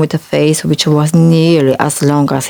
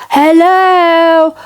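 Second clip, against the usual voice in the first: high, tiny shout; a small man shouting up at a giant; 13 semitones higher, 3 dB louder than usual. Acted. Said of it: "shouted at the top of his voice".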